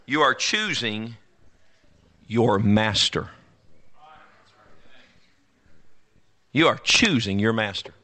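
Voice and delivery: slow, weighty choosing your master; solemn deliberate preaching; slow emphasis. Acted. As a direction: lay each word down slowly. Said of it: "slow deliberate emphasis".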